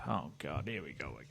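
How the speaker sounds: tired under-the-breath groan; weary mutter. Real, not acted. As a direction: sigh it out, fed up. Groaning at next picture.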